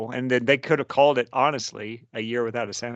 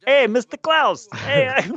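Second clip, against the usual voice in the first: put-on high, slow, loud Southern kid accent; 14 semitones higher, 8 dB louder, slower than usual. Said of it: "Southern accent I've ever heard".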